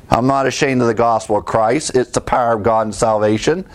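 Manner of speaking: ordinary delivery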